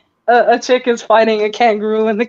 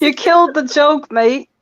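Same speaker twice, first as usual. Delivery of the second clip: put-on rough stab at an Australian accent; lightly put-on Aussie, higher on the first words; attempted Australian accent; 4 semitones higher than usual. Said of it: "aiming for an Australian accent".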